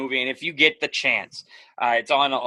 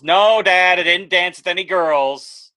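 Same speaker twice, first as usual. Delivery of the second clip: put-on louder, higher exasperated teen; huffy son protesting, louder; annoyed teen; 6 semitones higher, 8 dB louder, slower than usual. Son's irritated reply, louder.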